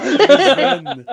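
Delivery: laughing hard and loud through the words. Real, not acted. GM cracking up at the table's joke, laughing loudly. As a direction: break up laughing on it.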